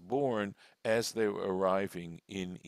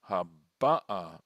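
"haba'a" with the middle "ba" stressed; 2 semitones lower, 8 dB louder than usual. Shown as a demonstration. Teacher reading the second verse, "haba'a" stressed on ba. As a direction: hit "ba" in "haba'a", letting the last a fall away. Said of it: "So now the accents on".

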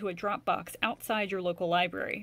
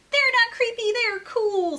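put-on much higher, louder kid protest; insistent little rabbit; 12 semitones higher, 7 dB louder than usual. Jasper insists to Mom the underwear is cool, high and protesting. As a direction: argue back like a kid who's sure. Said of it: "said Jasper".

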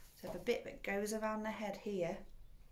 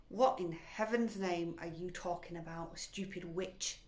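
ordinary delivery

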